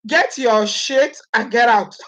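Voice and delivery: slow, every word spelled out; slow, firm dismissal. Shown as a demonstration. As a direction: say it slowly, throwing him out. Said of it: "saying it like the way it was said".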